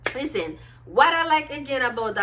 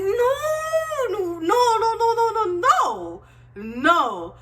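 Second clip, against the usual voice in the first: much higher, louder, drawn-out protests; frantic denial; 11 semitones higher, 3 dB louder, much slower than usual. Retold or imitated. Fan protesting the breakup twist, high frantic no's. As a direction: beg the story not to go there.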